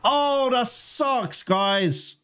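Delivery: long, very high wail of defeat; anguished wail. Real, not acted. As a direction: drag out each cry high in real dismay. Dies in the game.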